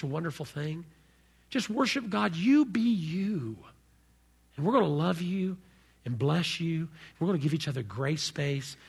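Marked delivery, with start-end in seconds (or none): none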